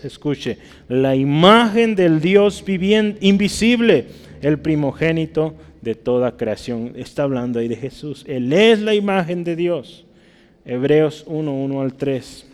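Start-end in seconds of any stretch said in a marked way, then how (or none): 0.9-6.9 emphatic verse reading. Real, not acted. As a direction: proclaim the scripture to the congregation. Proclaims verse from the pulpit.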